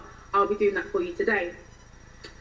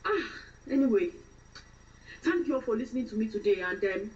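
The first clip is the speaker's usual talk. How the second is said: fresh-from-Nigeria woman, testifying and complaining; Nigerian accent; 4 dB quieter than usual. Shown as a demonstration. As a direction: play an aggrieved, devout Nigerian woman in London. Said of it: "start off with a fresh Nigerian woman".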